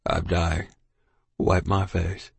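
hoarse, weak and low. Acted. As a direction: rasp it out on his deathbed. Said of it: "in the low, hoarse voice".

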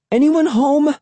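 high-pitched calling out. A narrator's reading. Sister calling to whoever is in the shack, forceful call. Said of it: "a little more forcefully".

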